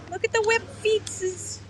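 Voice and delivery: put-on high baby talk, feetses cooed; cutesy baby talk. Seller cooing over the duck's feet, baby talk. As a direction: coo at the little feet.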